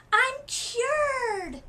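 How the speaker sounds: high, loud cry of triumph; joyful shout. A narrator's reading. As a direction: shout it out with pure relief. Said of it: "she shouted".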